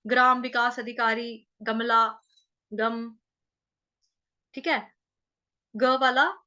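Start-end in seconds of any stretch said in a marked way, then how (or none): none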